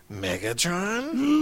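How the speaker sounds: slow drawn-out robot screech call; lightly voiced Transformer villain calling out; Starscream impression. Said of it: "doing Starscream impressions".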